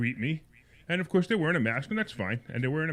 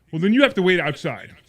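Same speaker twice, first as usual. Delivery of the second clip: raised, louder imitation of a manager; insistent order; 5 semitones higher, 9 dB louder than usual. Manager sends the buyer outside, retold insistent order. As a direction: push back loudly and higher, as a retold order.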